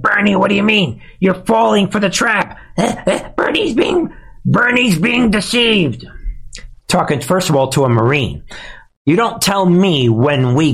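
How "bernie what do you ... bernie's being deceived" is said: high, sneering voice of a critic, mocking; mocking whiny critic. Mocking host imitates critics saying he's falling for a trap. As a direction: mimic the critic who calls him naive. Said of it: "You got some people like".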